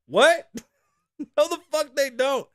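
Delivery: high, loud incredulous outburst; indignant disbelief. Retold or imitated. Patient disputing everybody has heart pain, loud high indignation. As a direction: burst out, replaying your outraged disbelief. Retold indignant reply to the doctor.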